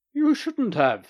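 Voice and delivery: high, loud flustered protest; mock protest. Doctor politely declines a gift, high flustered protest. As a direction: protest modestly, pleased.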